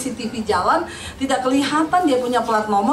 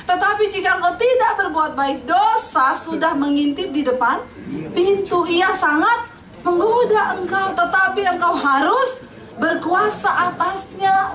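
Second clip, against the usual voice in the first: solemn scripture reading; 5 semitones higher, slower than usual. Acted. Solemn reading of God's warning.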